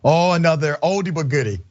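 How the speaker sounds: usual speaking voice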